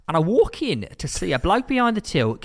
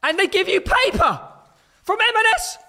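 high, loud exasperated rant; mock outrage; 5 dB louder than usual. Real, not acted. Complaining to his co-host about paper in crackers, high indignant rant. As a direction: squeal with disbelief at the crackers.

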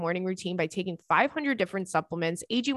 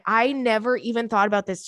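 ordinary talk, nothing marked

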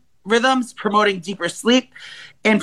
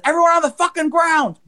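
robber's yell; 8 semitones higher than usual. Retold or imitated. Robber yelling at the shoppers, mock shout. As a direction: bark it like a gunman. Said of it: "The guy runs back and says".

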